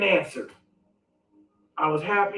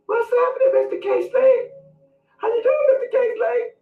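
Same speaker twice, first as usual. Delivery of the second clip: squeaky high voice, much higher; inner scared voice; 13 semitones higher than usual. Shown as a demonstration. Scared inside, greeting [name] in a squeaky high voice. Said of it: "inside I sound like this".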